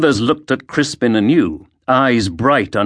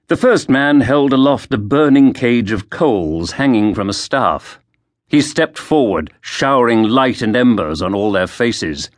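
usual speaking voice

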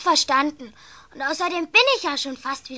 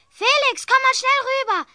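higher, urgent call; excited call; 10 semitones higher than usual. Acted. Anna calling her brother to the window, excited and high. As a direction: call him over quickly.

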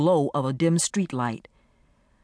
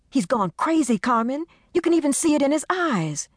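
high, frantic scared friend; frightened friend; 11 semitones higher than usual. Acted. Desiree telling Carmen her husband has gone crazy, frantic. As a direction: make her fear of him heard.

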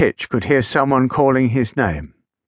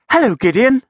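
high, bright little engine greeting; 11 semitones higher, 4 dB louder than usual. Acted. Small engine greeting his big friend, voiced high and light. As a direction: pitch way up as the tiny engine. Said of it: "said Little Titch".

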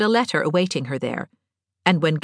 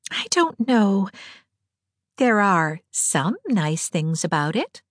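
put-on slow, higher, lightly girlish musing; Diana, hesitant; 5 semitones higher, slower than usual. A narrator's reading. Diana answering Anne about being grown up, hesitantly. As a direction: answer slowly, as if weighing it.

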